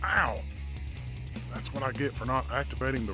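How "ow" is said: high yelp of pain; cry of pain. Acted. Hero hit by the drone's beam, pained yelp. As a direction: yelp as if struck.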